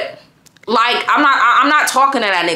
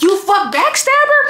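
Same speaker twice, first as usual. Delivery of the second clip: higher, angrier repeat of You fuck backstabber; repeating the accusation to the boyfriend, angrier and higher; 9 semitones higher than usual. Retold or imitated. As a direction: throw the same words back harder and higher.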